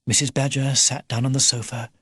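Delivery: ordinary delivery, nothing marked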